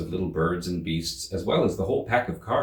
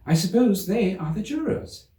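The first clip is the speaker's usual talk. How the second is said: put-on much higher, pleased girlish voice; proud little Alice; 11 semitones higher, 3 dB louder than usual. A narrator's reading. Alice proud of knowing the word, higher girlish voice. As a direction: show off the new word proudly. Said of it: "said this last word".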